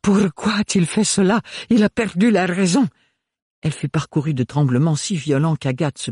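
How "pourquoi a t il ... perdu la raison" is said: high, shaken disbelief; a broken lady losing her composure; distraught noblewoman. A narrator's reading. Bérénilde, stunned by the news, in high distress. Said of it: "d'une voix blanche".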